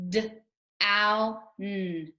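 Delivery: each sound said separately: L, ow, r. Shown as a demonstration. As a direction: sound out L, ow, r one by one, like a teacher. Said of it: "Let's go through and make the sounds".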